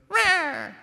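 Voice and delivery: tiny, high-pitched little roar; feeble cub roar. Acted. Cub facing the enemy to impress his girlfriend, squeaky roar. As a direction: squeak out a lion cub's roar, far up in pitch. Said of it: "he goes".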